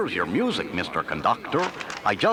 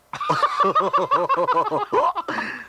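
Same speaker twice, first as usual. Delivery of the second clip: goofy laugh. Acted. Goofy laughs at his own joke.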